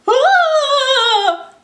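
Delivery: high, loud startled shriek; a sudden terrified cry; frightened scream. Acted. Monsieur Dupont seeing the lion, high shrieking cry.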